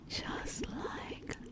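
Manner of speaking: very soft, hushed whisper; soothing whisper. Acted. Lamia soothing her pet to sleep in the water, whispering. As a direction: whisper them gently to sleep.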